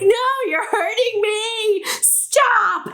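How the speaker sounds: put-on high, whiny voice of a small boy begging; hurt kid pleading. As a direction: play the little brother pleading to stop. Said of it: "You know, like".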